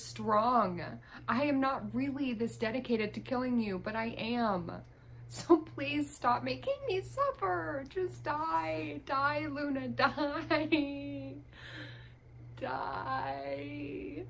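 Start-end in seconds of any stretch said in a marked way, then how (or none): 5.3-14.2 higher, drawn-out hammed-up begging; playfully desperate plea; mock pleading. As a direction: beg with exaggerated despair, stretching the last words. Begging Sim Luna to die.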